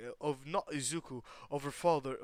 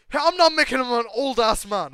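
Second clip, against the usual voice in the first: yelling at chat; 9 semitones higher, 16 dB louder than usual. Real, not acted. Yelling at chat about age.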